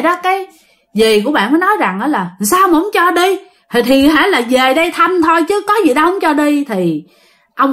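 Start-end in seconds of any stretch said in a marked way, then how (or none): 2.4-6.6 higher-pitched, acted reassurance; aunt's higher voice, reasoning with a spirit; protesting aunt. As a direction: protest in a raised voice. Aunt protests to possessing grandfather.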